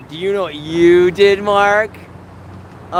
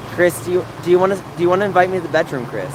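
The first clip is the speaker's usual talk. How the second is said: fast teasing; much faster than usual. Pretend. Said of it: "repeats the request faster".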